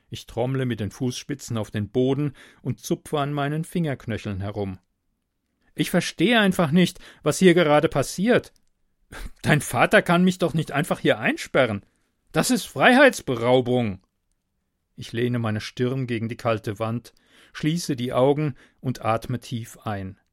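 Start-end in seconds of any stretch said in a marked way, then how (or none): 5.7-14.0 louder, exasperated protest; upset protest. Acted. Locked-in man protests to the girl, louder and exasperated. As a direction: push back, frustrated and indignant.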